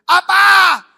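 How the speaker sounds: explosive high "what?"; imitated husband's yell, very loud; booming retort. Husband re-enacts answering wife's complaint about his voice, loud shout. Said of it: "Suaranya boy".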